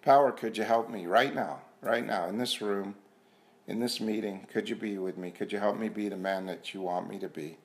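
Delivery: quiet prayer. Acted. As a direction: pray under your breath to the power. Quiet prayer to higher power.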